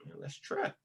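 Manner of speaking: hushed retold whisper. Homie whispering agreement to start a fight in the day room.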